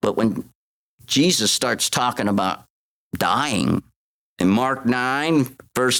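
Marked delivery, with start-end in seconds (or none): none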